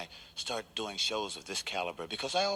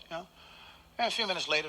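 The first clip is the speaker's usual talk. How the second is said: ordinary talk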